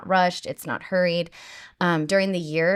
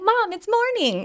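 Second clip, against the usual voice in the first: put-on much higher, slow child's wake-up call; little boy voice; slower than usual. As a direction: imitate a happy little boy at dawn. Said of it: "He's like".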